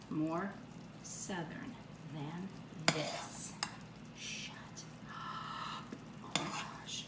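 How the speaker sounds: breathless whisper of awe; whispered amazement. Real, not acted. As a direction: whisper it in disbelief. Amazed at the Southern food.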